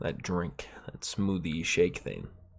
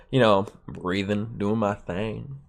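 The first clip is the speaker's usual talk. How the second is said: ordinary talk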